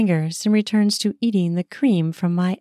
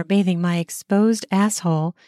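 ordinary delivery, nothing marked

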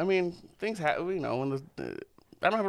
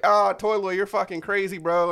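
put-on louder, higher cheering kid voices; mock hyped kids; 7 semitones higher, 5 dB louder than usual. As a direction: hype him up like schoolkids. Kids cheering him in retold story.

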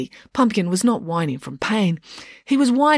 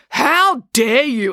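slow, loud, higher indignation; outraged exclamation; 6 semitones higher, 6 dB louder, much slower than usual. A narrator's reading. April is accused of panicking, outraged exclamation. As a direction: draw it out, deeply affronted. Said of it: "exclaimed April".

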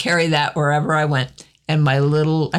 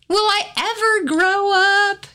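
put-on whiny kid; 14 semitones higher than usual. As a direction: whine like an impatient child. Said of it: "Kids always say".

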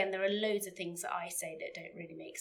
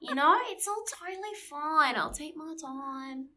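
put-on light Australian accent, higher, laid-back; mock Australian accent; 7 semitones higher than usual. Mocking slow Australians to her cohost, light Australian accent. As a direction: play a relaxed Aussie with no rush. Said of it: "That's all, that was all right".

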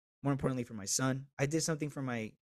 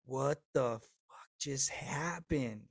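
stunned, near tears; much slower than usual. Retold or imitated. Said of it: "embrace the cry".

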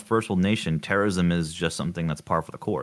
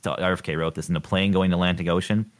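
ordinary delivery

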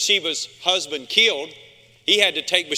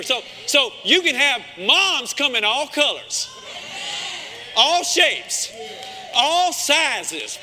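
preaching at full force; 6 semitones higher, much slower than usual. Real, not acted. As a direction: preach it out to the whole church.

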